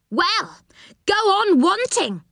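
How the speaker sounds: high-pitched, irritated character voice; high, huffy dismissal; cross refusal. A narrator's reading. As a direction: refuse scornfully. Angry voice refuses Moonface.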